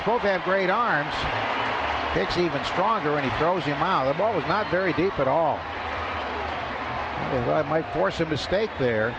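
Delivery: ordinary delivery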